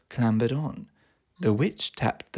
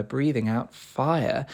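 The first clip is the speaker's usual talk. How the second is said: ordinary delivery, nothing marked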